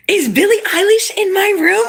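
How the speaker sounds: high-pitched, astonished question; excited disbelief. Real, not acted. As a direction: squeal it as if a star just walked in. Reacting to a viewer's username.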